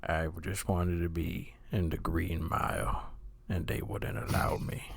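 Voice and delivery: lightly voiced low, soft narrator; a hint of a hushed narrator; Morgan Freeman impression. Friend does a low, soft mock narrator impression. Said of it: "Morgan Freeman impression".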